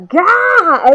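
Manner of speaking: loud, high drawn-out yell; battle cry. Retold or imitated. Reader voicing Endeavour launching himself forward, battle cry. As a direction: let out a straining attack cry.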